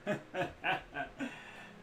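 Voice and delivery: breathy laugh. Acted. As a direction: laugh hard at your joke. Character laughs at own joke.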